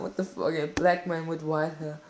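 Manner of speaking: normal talking voice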